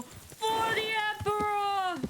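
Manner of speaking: high, slow rallying cry to the Emperor; hammed-up holy war cry; zealous battle cry. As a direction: proclaim it like a fanatic soldier. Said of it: "I just know".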